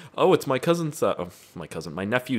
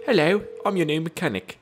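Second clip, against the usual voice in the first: high put-on British accent; squeaky mock-British greeting; British mechanic; 9 semitones higher than usual. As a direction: play a British mechanic, pitched way up. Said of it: "why are you British?".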